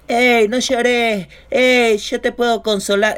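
high, drawn-out Argentine come-on; drunk Argentine accent.

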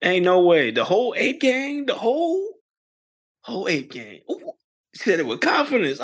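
ordinary talk

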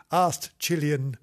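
ordinary delivery, nothing marked

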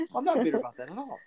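fast, high, mock-innocent denial of bitterness; sarcastic denial. Real, not acted. To the co-host, denying bitterness, sarcastic. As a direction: pretend you're not bitter at all. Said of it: "A little bit".

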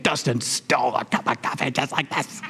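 an inner voice that won't let it go; resentful inner thought. Pretend. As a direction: replay the grievance in your head, slightly hushed. Resentful memory of stolen coffee.

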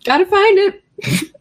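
high, faux-helpful excuse; feigned innocence. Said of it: "I'm like".

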